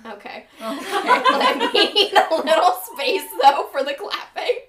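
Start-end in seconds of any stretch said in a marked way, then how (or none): 0.6-4.6 giggling through the words; overeager flirty giggling. Real, not acted. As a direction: laugh while asking for space. Giggling through host lines.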